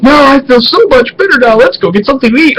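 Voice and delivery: high, light, chirpy voice; relieved, cheery dad. Retold or imitated. Dad to son after the ordeal, cheerily relieved. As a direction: act out a suddenly perky dad.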